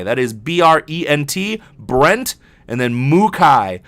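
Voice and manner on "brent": ordinary delivery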